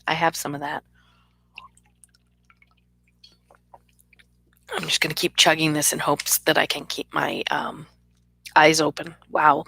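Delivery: hoarse, gravelly allergy voice; rough, gravelly throat. Real, not acted. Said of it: "which is why I sound gravelly".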